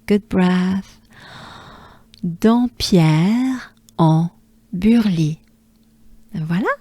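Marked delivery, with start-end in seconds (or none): 2.2-5.4 Dampierre en Burly slowly, in three groups; French pronunciation. Shown as a demonstration. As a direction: model the whole French name "Dampierre en Burly". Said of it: "Do it with me".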